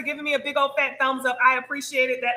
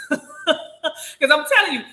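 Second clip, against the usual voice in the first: laughing through the words, a bit higher and louder; 4 semitones higher, 5 dB louder than usual. Real, not acted. Bragging to viewers about being smart, laughing. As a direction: giggle out a playful brag.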